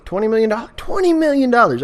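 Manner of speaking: high, excited shriek of twenty million; shrieking jackpot winner. Pretend. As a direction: squeal it like you just hit the jackpot.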